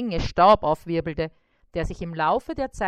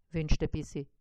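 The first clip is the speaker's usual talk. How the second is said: plain reading voice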